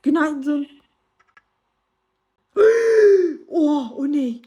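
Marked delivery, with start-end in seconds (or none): 2.5-3.4 sharp high gasp of shock; loud startled gasp. Real, not acted. Sudden attack at dawn. (yüksek sesli şaşkın nefes)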